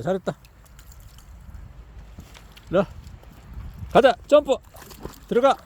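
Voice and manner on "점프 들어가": ordinary delivery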